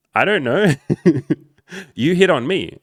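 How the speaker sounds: high, loud mock-female voice with a giggle; imitated flustered woman. Coach acting out a woman he approached, high mock-female voice. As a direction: pitch it up and push it, mimicking her.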